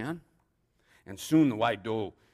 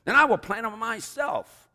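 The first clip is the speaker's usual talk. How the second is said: high, loud hen declaration; high-pitched, self-reliant storybook hen; indignant storybook hen; 13 semitones higher, 8 dB louder than usual. A narrator's reading. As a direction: huffily announce it as the hen. Hen declares she will do it.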